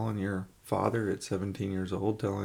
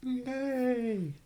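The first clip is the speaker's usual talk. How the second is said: mock cheer; 13 semitones higher than usual. Mock cheer for new year.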